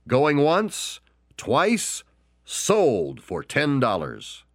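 rising then slow final sold; high auctioneer call settling to sold; going once call. Acted. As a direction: bring the gavel down. Auctioneer closing the sale.

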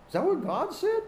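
high-pitched mock incredulity, rising at the end; sly, high, questioning lilt; doubting question. Voicing serpent's doubting question to Eve.

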